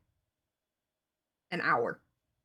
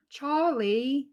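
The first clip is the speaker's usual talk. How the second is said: Charlie with a higher, louder rising voice; Charlie the Unicorn voice; 6 semitones higher, 6 dB louder than usual. Shown as a demonstration. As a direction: sing out Charlie like the unicorn cartoon. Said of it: "Charlie the Unicorn stuff".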